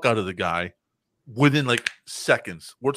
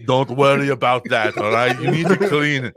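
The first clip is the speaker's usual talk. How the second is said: mock scolding robot; 2 semitones higher, 6 dB louder than usual. Terminator scolding about the room.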